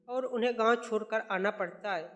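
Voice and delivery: plain reading voice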